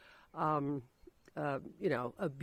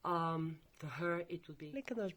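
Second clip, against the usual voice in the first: usual speaking voice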